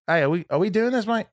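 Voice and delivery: put-on Australian accent. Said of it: "always wants to do the Australian accent".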